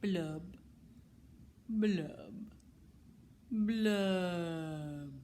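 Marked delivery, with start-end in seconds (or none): 1.7-5.1 glum fish blubs. Acted. Glum bubbling refrain.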